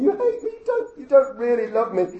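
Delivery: high, loud, hammed-up sobbing plea; mock sobbing wife. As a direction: wail it like a wronged bride, half in jest.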